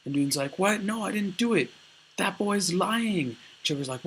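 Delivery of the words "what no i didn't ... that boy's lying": high-pitched, indignant protest of innocence; acted shocked denial. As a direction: protest as if wrongly accused. Accused character denies it.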